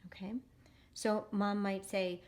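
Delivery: normal talking voice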